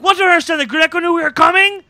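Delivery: high, loud, acted demand; heated interrogation. Host retells agent grilling the captive, heated. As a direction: fire it back as an outraged question.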